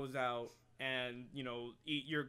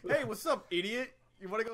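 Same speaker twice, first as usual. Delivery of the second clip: rough gamer taunt; 7 semitones higher, 6 dB louder, faster than usual. Retold or imitated. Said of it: "In real life, it'd be like".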